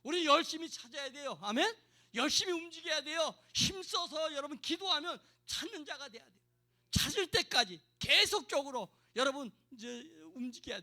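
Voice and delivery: loud, insistent exhortation; forceful preaching. Real, not acted. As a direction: drive each phrase home to the congregation. (힘주어 외치는 설교)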